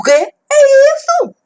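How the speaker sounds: high, loud anguished shout; a loud, high furious wail; furious cry. Acted. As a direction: explode with rage. Outburst of rage.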